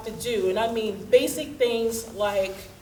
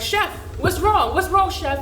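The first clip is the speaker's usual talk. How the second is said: worried crew; 8 semitones higher, 6 dB louder than usual. Retold or imitated. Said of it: "they're like".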